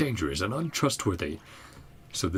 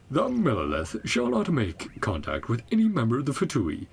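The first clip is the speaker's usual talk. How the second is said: loud, high-pitched notice reading; official proclamation; 5 semitones higher, 4 dB louder than usual. Acted. Game player reading an official notice aloud, proclamation voice. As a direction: declaim it like a town crier.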